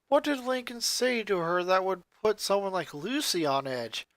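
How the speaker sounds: plain reading voice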